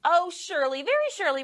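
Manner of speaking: put-on high, sweet boy pleading; sweetly urging little boy; coaxing boy voice. A narrator's reading. Boy voice in story.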